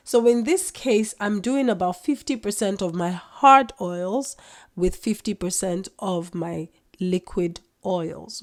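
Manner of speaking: normal talking voice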